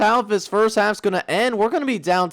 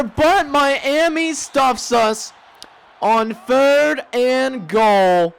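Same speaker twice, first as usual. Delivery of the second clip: frustrated groaning; 8 semitones higher, 3 dB louder, much slower than usual. Real, not acted. Stopped at the goal line.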